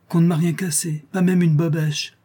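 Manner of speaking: high, pleased little cry of relief; delighted exclamation. Acted. Aunt Belle marvelling to her husband, delighted exclamation. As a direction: crow over the unbroken china.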